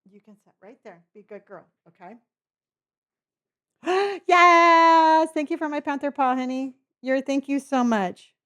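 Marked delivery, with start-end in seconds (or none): 3.8-5.3 high, loud gasp of joy; gasping delight. Real, not acted. Thrilled praise of her cat. 5.3-8.3 squeaky praising a dog. Real, not acted. Baby-talk thanks to the cat.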